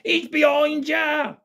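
high, drawn-out mock shout; panto audience shout. Panto audience warning Buttons of the ghost, high mock shouting. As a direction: imitate kids calling out, very high and slow. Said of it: "they shouted".

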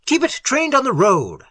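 high-pitched urgent command; shouted order. A narrator's reading. Little ordering Algernon to aim the light, shouting. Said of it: "shouted Little".

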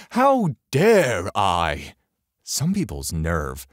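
slow, drawn-out mock indignation; mock outrage. A narrator's reading. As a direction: stretch it out in pretend horror. Mocks the woman's complaint.

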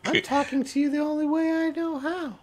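tiny mushroom, very high squeak; Stool's little voice. Acted. Mushroom reassures someone hearing voices, tiny high voice. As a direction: introduce yourself in a small, high creature voice. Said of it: "this little voice in your head".

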